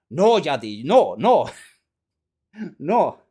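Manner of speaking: loud mock protest, each "no" punched; urgent warning. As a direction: warn him off loudly. Yakuza frantically warns JD in retelling.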